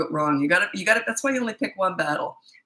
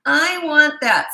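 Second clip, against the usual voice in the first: high, slow whining plea; mock toddler whine; whiny child voice; 9 semitones higher, 6 dB louder, much slower than usual. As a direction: whine it like a spoiled kid. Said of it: "you know the tone of voice that you use".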